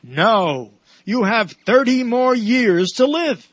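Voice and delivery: loud, slow pronouncement from on high; booming divine verdict, drawn out; God. Acted. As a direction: declare it as God, big and high. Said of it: "God says".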